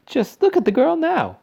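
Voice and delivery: very high, loud, appalled mother; distressed cry. A narrator's reading. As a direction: wail for all to look. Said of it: "cried Missus Beauregard".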